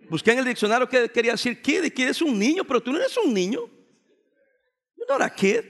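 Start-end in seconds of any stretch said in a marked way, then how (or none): 5.0-5.6 emphatic retort. Acted. Preacher scolding teens in English.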